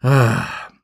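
heavy acted sigh; exasperated exhale; big sigh. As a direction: let out a long, weary sigh. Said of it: "with a big sigh".

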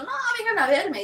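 high-pitched, insistent plea; mock pleading. Patient urging reluctant friends to come visit, mock pleading.

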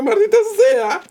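very high, squeaky mouse cursing; cornered mouse. Retold or imitated. Retelling the meme where a cornered mouse curses, squeaky high voice. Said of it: "sale el ratoncito ese que está así como en una esquina".

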